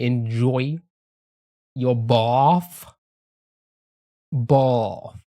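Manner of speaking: put-on "bath" drawn out in an English accent. Streamer playing up how they say it in England, English accent. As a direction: lean into the English way of saying bath. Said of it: "as they say here in England".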